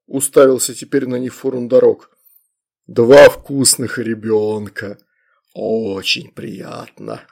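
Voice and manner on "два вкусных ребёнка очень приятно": very slow, low demon relish; menacing demon. A narrator's reading. Demon greets two tasty children, menacing relish.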